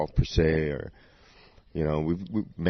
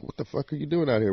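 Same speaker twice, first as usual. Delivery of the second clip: high-pitched mock disbelief; higher, incredulous quoted question; retold surprise; 8 semitones higher than usual. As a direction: act out being surprised to run into a friend. Retold surprise at chance meeting.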